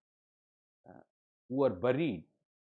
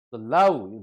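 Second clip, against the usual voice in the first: "love" punched much louder, with falling pitch; stress on love; 3 semitones lower, 10 dB louder than usual. Real, not acted. Lecturer telling students where the emphasis is, "love" stressed loudly. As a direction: hit the word "love" hard, letting it drop. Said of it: "the emphasis on love".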